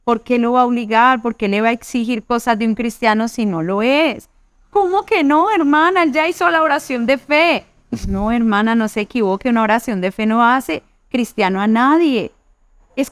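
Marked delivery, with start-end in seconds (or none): 4.7-7.6 indignant surprise. Retold or imitated. Wife protests to sister that husband prayed, high indignant mock. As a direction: protest as if insulted.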